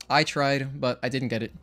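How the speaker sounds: usual speaking voice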